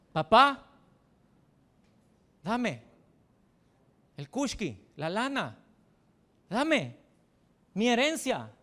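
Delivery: high, loud brash son demanding money; cheeky demand. Acted. Preacher voicing the cocky younger son demanding his inheritance.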